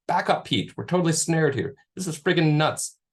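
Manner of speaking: frustrated shout. Acted. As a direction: call out annoyed and urgent. Kevin snared, frustrated in fire.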